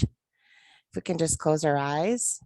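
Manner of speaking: ordinary talk, nothing marked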